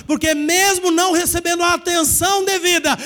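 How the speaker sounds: normal talking voice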